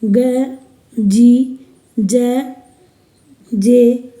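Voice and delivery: normal talking voice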